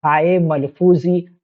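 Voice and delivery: normal talking voice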